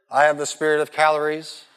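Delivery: ordinary delivery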